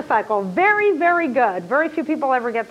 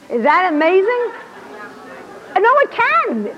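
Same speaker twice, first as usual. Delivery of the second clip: amazed; 6 semitones higher, slower than usual. Real, not acted. Teacher excited about brain fact.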